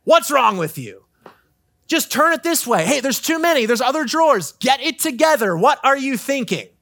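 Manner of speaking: high, loud, exasperated scolding; frustrated parent yelling. Retold or imitated. Parent yelling at a toddler over the drawers, exasperated and loud. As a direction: snap at the toddler like a fed-up parent. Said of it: "what do you think a parent does".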